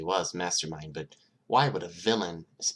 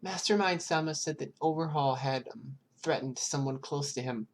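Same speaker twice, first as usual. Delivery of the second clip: put-on high, timid small child voice; scared child answering a detective, high and hesitant; little girl voice; 9 semitones higher than usual. Eri explains killing.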